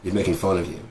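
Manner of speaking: ordinary delivery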